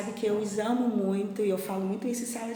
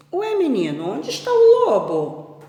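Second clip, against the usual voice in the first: high, louder storybook farmer; swooping, raised-pitch question; puzzled questioning; 7 semitones higher, 11 dB louder than usual. Acted. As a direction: ask the boy in bewilderment. Farmer questions boy about wolf.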